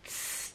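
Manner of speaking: voiceless "tss", sharp T released into hiss; short burst then held hiss on "tss"; TS sound. Shown as a demonstration. Teacher isolating German Z for learners, sharp hiss. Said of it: "ele faz som de tss".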